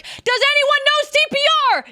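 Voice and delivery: high, loud call to the crowd; shouting for help at a crowd; yelled call. Shown as a demonstration. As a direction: yell it out for everyone to hear. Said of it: "You yell it as loud as you can".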